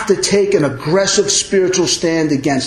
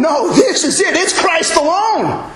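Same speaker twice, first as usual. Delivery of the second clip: high, forceful declaration; uncompromising preacher's cry, pitched high; dogmatic Paul; 12 semitones higher than usual. Acted. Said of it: "that's how Paul fought against these people".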